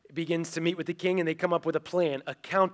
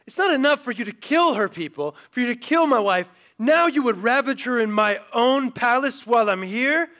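higher, louder angry accusation; furious king; 6 semitones higher, 6 dB louder than usual. Retold or imitated. King walking in on Haman at the couch, furious. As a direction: lay into him for the outrage.

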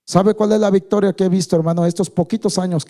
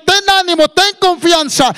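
high, loud rallying cry; preached exhortation; 12 semitones higher, 5 dB louder than usual. Acted. Preacher relays the call to the blind man, loud exhortation. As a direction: proclaim it high at full preaching force. Same encouragement, now proclaimed.

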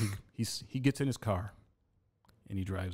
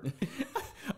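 squeaky burst of laughter, pitched way up; high squealing laugh; 5 dB quieter than usual. Real, not acted. Telling friends about the fight, high squeal of laughter. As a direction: let out a high giggle at the memory.

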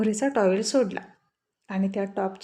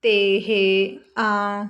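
nervous fumbling sounds; stumble, lost for words; stammering; 4 dB louder, much slower than usual. Acted. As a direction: caught out, stuttering. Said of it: "त, प, प करत म्हणाला".

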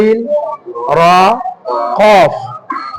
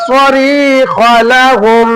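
slow, higher melodic tajweed chant; Quran recitation; 4 semitones higher, much slower than usual. Acted. Teacher chanting the verse for the student to repeat, slow and melodic. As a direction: draw each word out in a recited melody.

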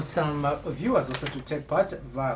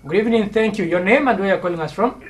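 loud, higher phone greeting; calling out; 5 semitones higher, 8 dB louder, faster than usual. Real, not acted. Host greeting a faint caller on air, calling out. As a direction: project brightly down a poor line.

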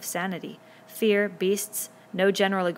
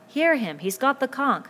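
high, loud command; loud insistence on order; shout; 7 semitones higher, 6 dB louder than usual. A narrator's reading. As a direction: shout over the uproar. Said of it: "Ralph shouted".

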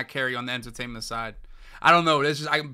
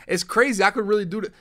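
ordinary talk, nothing marked

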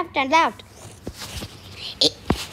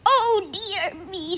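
man character; 4 semitones higher, 4 dB quieter, slower than usual. Acted. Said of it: "said the".

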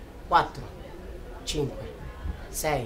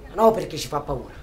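ordinary talk

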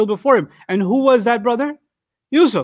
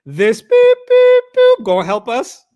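much higher, louder mock jeer; mocking rude customers; 6 dB louder, slower than usual. Translating the rude customers, loud high mock jeer. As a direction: ham up the rude guys cursing. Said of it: "let me translate what they said".

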